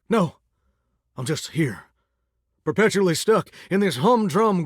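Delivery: ordinary talk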